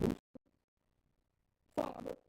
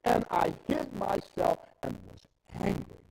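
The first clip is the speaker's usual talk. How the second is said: preaching at full force; 10 semitones higher, 11 dB louder than usual. Acted. Preacher voices God's anger at greed, loud full-force preaching. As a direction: thunder God's wrath from the pulpit.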